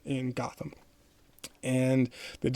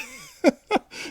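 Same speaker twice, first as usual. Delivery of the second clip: high burst of laughter; real high-pitched laugh; sudden laugh. Real, not acted. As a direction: crack up at your own slip. Cracks up at own slip.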